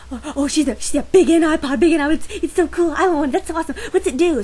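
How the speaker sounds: high, loud gushing schoolkid voice; imitating excited classmates. To her about the e-reader, gushing classmates mimicked. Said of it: "But they go".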